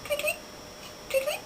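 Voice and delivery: tiny, very high, soft chirps; bird tweeting. Pretend. Playing animal noises as a bird, high tweeting. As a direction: tweet softly like a little bird. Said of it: "I am a bird".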